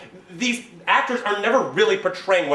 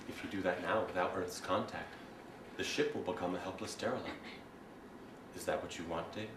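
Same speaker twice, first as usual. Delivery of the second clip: HAL computer voice; 11 semitones lower, 16 dB quieter than usual. Acted. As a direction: speak like a cold ship computer.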